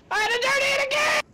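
very high, loud, rapid yell; hammed-up screech of frustration; exasperated screech. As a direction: shriek it out all in a rush. Yelled gripe about redoing work.

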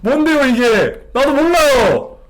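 shaken, blurting confusion; flustered outburst. Real, not acted. Streamer to chat after the scare, flustered and loud.

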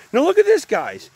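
sudden high excited call to look; high and bright, eager to show the plant. Real, not acted. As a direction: point it out with a jump of delight. Showing a surprising plant.

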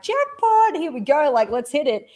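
high-pitched eager hype; mock-thrilled cheer; excited hype. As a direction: pump yourself up, jackpot energy. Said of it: "I'm like".

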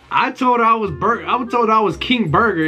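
ordinary talk, nothing marked

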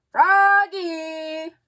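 loud, high fatherly call; dad yelling up the stairs. A narrator's reading. Father calling his son awake, loud. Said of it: "called his father".

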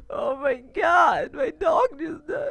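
high, slow hammed-up laughing cry; high drawn-out fake laughing wail; mock laughing shout. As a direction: parody a YouTuber laughing at bad news. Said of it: "they always fake laugh".

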